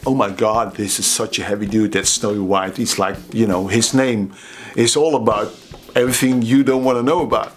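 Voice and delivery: normal talking voice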